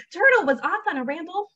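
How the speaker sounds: lively, sing-song storybook delivery; animated picture-book narration; story reading to children. A narrator's reading. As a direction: read it to little ones with big pitch swings.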